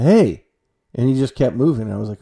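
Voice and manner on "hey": louder, higher call; startled "hey" thrown after a stranger. Retold or imitated. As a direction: call after someone walking away. Calling after a passing stranger.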